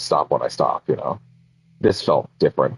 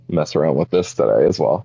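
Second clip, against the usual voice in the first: ordinary talk, nothing marked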